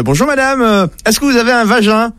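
high-pitched, put-on caller's voice; odd doorstep stranger. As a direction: play the strange visitor, pitched well up. (voix aiguë de visiteur bizarre)

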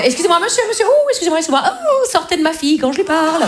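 high, flustered protests rising in pitch; indignant mother. Acted. Mother ordering a man off her daughter, high and indignant. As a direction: interrupt in a high, scandalised mother's voice.

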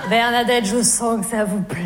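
low, deep mock male voice; Jean-Loup's voice. Jean-Loup wrongly thinks she likes it, in a low mock-male voice. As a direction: purr it like a smug suitor.

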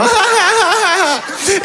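high, rising fake giggle; polite laugh. Giggling for his banker, high and forced. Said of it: "le rire de politesse".

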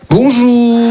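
mock receptionist hello; chirpy host greeting. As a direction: greet like a cheerful desk clerk.

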